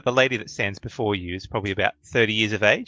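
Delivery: ordinary talk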